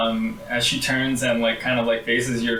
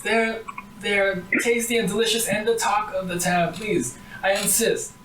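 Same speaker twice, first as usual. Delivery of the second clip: lightly voiced sweet granny; a hint of a doting grandma; old granny; 10 semitones higher than usual. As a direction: press the pies on them kindly.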